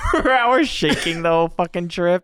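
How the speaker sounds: laughing through the words; high-pitched laughing voice. Real, not acted. Laughing while recalling crazy drive.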